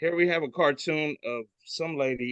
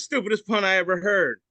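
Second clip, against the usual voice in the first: higher, louder scoff; annoyed fly; 8 semitones higher, 5 dB louder than usual. Retold or imitated. Fly complaining about the pun, higher scoff. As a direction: groan at the pun. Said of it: "And the fly says".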